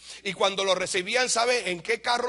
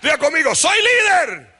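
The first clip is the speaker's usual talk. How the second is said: fiery preaching shout; 4 semitones higher, 9 dB louder than usual. Acted. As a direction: lift the whole church with a shouted exhortation. Preacher exhorts congregation, shouting. (exhortación predicada a gritos, subiendo de tono)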